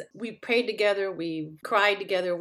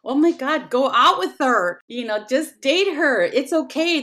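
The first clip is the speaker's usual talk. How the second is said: higher, louder encouraging voice; excited daughter; 6 semitones higher, 6 dB louder than usual. Retold or imitated. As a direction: push mom eagerly to date. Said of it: "my daughter said".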